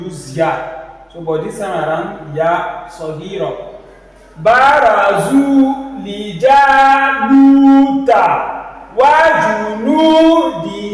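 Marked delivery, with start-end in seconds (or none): 4.4-10.9 slow, high sung recitation of "Barazu li Jaluta"; stretched vowels. Shown as a demonstration. Teacher reciting the verse, long vowels chanted. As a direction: chant it, holding "zu", "lu" and "hi". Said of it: "if you want to, uh, stretch".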